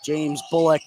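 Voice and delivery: usual speaking voice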